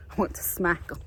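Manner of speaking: ordinary talk, nothing marked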